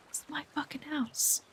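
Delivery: hushed, high, very soft grumble; quiet aside. Real, not acted. Woman grumbling that she left her own house, hushed. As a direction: repeat it under your breath, high and tiny.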